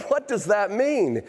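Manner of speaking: high, slow bewildered question; mock puzzled. Customer at the front desk asking, high and slow in mock bewilderment. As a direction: drag it out in fake confusion. Receptionist laughs, he reacts.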